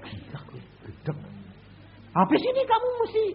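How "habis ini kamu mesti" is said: high loud threatening voice; retold threat. Threatening a man with the police, high and loud. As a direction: raise the voice to threaten.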